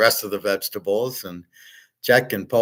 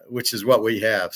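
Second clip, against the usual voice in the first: normal talking voice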